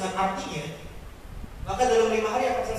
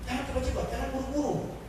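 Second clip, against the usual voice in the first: usual speaking voice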